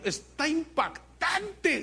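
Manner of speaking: slow, high-pitched gush of amazement; stretched-out, hammed-up wonder; imitated awe. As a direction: mimic an awestruck churchgoer. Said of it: "alguien me dijo, "Pastor".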